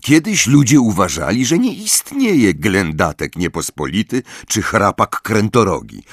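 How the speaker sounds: ordinary delivery, nothing marked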